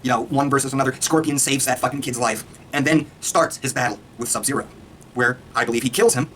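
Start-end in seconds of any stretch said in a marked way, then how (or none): none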